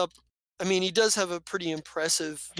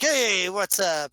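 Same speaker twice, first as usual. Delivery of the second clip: casual greeting; 3 semitones higher, 4 dB louder, slower than usual. Acted.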